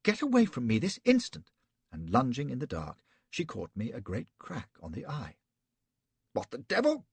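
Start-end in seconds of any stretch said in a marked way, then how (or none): 0.0-1.5 high, loud, indignant woman's voice; outraged lady. A narrator's reading. Betty orders Flashman away, high and outraged. 6.3-7.1 startled man. A narrator's reading. Flashman startled by blow.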